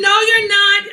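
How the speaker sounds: loud, high, firm no; retold angry snap; angry refusal. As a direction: shut him down hard. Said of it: "I was mad".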